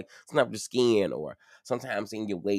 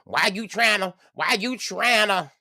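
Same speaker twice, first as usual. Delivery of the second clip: higher, louder mock of an annoyed partner; imitating an upset partner; 11 semitones higher, 5 dB louder than usual. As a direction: whine it like someone caught off guard. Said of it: "if they get mad at me and like".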